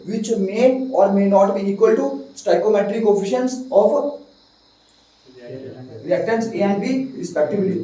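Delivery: slow dictation pace; slowly, word by word for notes; dictating. Shown as a demonstration. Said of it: "बात समझ आ गई भाई?".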